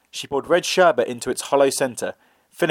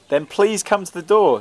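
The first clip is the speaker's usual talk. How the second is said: put-on higher, thin old man voice; old man's voice; 6 semitones higher than usual. A narrator's reading. Disguised young man answers the girl, old man's voice. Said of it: "in an old man's voice".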